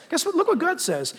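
normal talking voice

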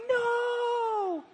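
very high, long drawn-out cry; hammed-up wail of dread; mock horrified wail. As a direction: wail it like a bride in comic panic. Acting bride dreading groom's coming.

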